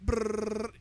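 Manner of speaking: high, drawn-out lip trill; slow brrr of a spin; imitated spinning noise.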